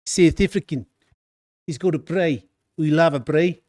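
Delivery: hammed-up South African voicing of "brie"; playful, amateur South African accent for a Cape Town viewer; bad South African accent. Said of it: "Sorry for my terrible, um, accent".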